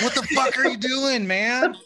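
high-pitched incredulous protest; mock outrage. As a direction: react appalled to a creep, voice jumping up. Acted reaction to a creepy stranger.

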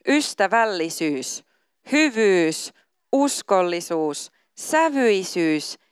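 slow, emphatic list of the fruits of the spirit; scripture reading. Acted. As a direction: savour each virtue as you read it. Reads Galatians passage aloud.

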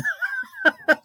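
squeaky high laugh; high giggle. Real, not acted. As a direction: giggle at your own joke. Laughs at own joke.

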